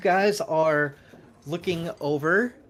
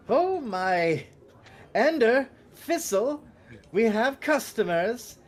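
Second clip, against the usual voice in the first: put-on higher, kindly old shopkeeper calling to his clerks; kindly Santa-like shopkeeper; 7 semitones higher, slower than usual. Kindly shopkeeper calling his clerks to greet customers, higher voice. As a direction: sound like a jolly Santa Claus calling out back. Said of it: "has the demeanor of Santa Claus, just very kindly".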